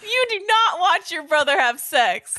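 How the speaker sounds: high-pitched, drawn-out incredulous outcry; slow it down in squeaky mock outrage, breaking into a laugh; exaggerated disbelief. Podcaster reacts to the sister watching her brother, exaggerated disbelief.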